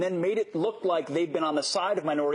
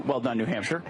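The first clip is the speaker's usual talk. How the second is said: usual speaking voice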